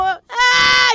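loud, high imitated toddler wail; imitating a baby's crying wail; mock baby cry. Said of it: "Y fue como".

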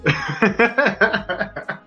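high airy laugh. Real, not acted. Host joking about the delivery app, laughing.

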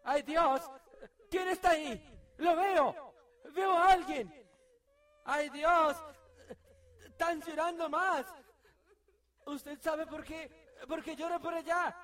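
high, loud panicked exclamations; scared out of her wits, crying out; rising panic at a crying ghost; frightened cries. Real, not acted. Frightened player at crying sounds.

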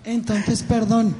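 hoarse, strained voice; talking through a hoarse throat. Real, not acted. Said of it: "es que yo estoy ronca".

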